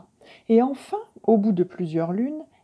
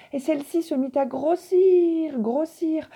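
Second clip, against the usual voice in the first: growing wonder; 8 semitones higher than usual. A narrator's reading. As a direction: let the words swell like the land. The earth swelling. (grossir, grossir, de plus en plus émerveillé)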